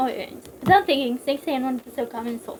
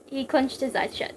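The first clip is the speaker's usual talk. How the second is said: ordinary delivery, nothing marked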